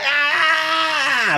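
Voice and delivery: high excited shout; excited scream. Retold or imitated. Comic imitating Fab's scream in the crowd, high. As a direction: yell out from the front row. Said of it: "Fab's in the front".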